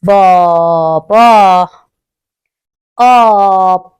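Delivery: بابا stretched very slowly, each syllable held; drawn-out sounds. Shown as a demonstration. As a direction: draw out بابا slowly for the child to hear every sound.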